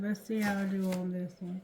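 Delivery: usual speaking voice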